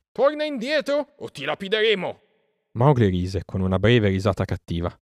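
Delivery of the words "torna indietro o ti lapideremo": high, distant threatening cry; shouting crowd. A narrator's reading. Crowd threatening Mesua with stones, shouting. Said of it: "gridò la folla".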